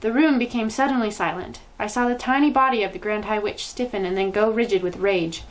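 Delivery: ordinary delivery, nothing marked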